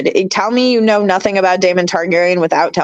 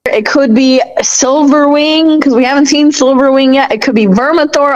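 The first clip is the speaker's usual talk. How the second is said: excited guessing; 5 semitones higher, 5 dB louder than usual. Real, not acted. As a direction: stretch the dragon names out eagerly. Guessing which dragon appeared.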